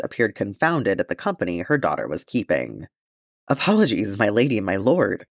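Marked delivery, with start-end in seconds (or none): none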